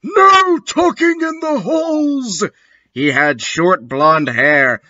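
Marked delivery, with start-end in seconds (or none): 0.0-2.4 very high hammed-up screech; angry shouting. A narrator's reading. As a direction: screech it like a cross headmaster. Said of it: "shredding at us angrily".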